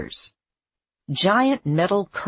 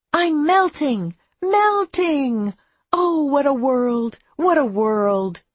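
high, loud, drawn-out wail; Wicked Witch; 11 semitones higher, 6 dB louder, slower than usual. Acted. Abby acts out the witch from the movie, wailing. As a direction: melodramatic dying lament, falling away at the end. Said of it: "The witch says".

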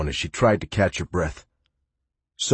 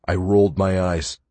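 plain reading voice

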